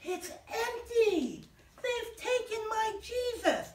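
high, slow distraught wail. Acted. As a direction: draw out the dismay, high and slow. Mary finds the tomb empty.

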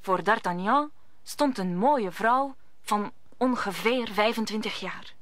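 ordinary talk

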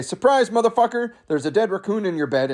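high, quick mock-triumphant burst; gleeful surprise announcement. Raccoon on the pillow to Victor, gleeful surprise.

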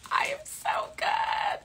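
high, slow whispered boast; proud whisper. Real, not acted.

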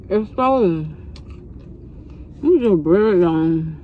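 chewing while talking; talking between bites; mouth full. Real, not acted. Seen 2: Bitten bread held up; lips pursed, jaw working after bite at -4.

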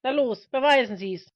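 loud, high dare; daring challenge. Acted. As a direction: throw the challenge out loudly. Preacher dares his accuser.

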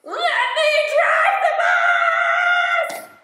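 very high, loud, slow screaming pigeon; tantrum. Acted. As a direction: scream it out in a meltdown.

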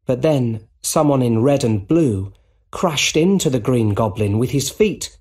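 plain reading voice

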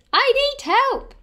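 drawn-out high, loud wail; panicked wail. A narrator's reading. Panicked baker pounces on Grandma for help, wailing high and loud. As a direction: wail it slowly, pitch shooting up. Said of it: "he wailed".

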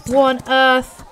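ordinary talk, nothing marked

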